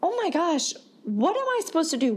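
high, rushed anxious inner voice; retold panic. To herself as the cyclist rides away, panicked. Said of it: "And I'm thinking".

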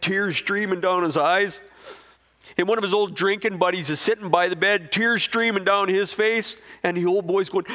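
high, loud, passionate preaching; full-force preaching. Acted. Preacher building to a loud, high peak. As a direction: preach it out to the crowd, climbing.

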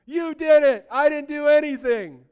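high, loud burst of awe; amazed exclamation. Acted. Believer amazed at what God did, high excited shout. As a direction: cry it out, thrilled and astonished.

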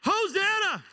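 high, loud hosanna; excited high hosanna; joyful praise. Pretend. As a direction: shout it out in praise. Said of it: "I'm gonna say".

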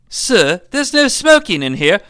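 high, loud nurse voice, building; scolding nurse. Acted. Nurse to a smoking father, building scold. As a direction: reprimand him, rising to a loud call.

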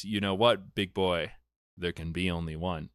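low, quiet menacing villain line; a hint of a sneering villain; villain quote. Retelling the Kurgan's kill line, low and menacing. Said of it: "and he goes".

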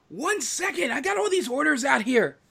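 harried, very high-pitched holler; calling back. Acted. Bartender answering the yelling cook over her orders, high-pitched holler. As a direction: call it back high, under pressure.

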